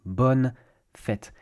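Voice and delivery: Bonne fête said slowly, bun fet; model pronunciation. Shown as a demonstration. Teacher models Bonne fête for learners, clear and careful. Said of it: "another way to say the same thing is bonne fête".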